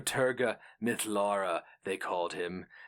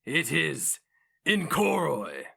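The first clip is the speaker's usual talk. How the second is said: higher, louder, slow hate-filled spit of the name; spat with hatred; 7 semitones higher, 4 dB louder, slower than usual. Acted. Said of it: "He spat the name".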